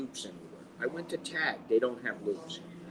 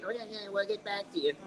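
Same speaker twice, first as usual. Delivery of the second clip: mocking brush-off; 8 semitones higher, 4 dB quieter, faster than usual. Mocking the company's empty promise.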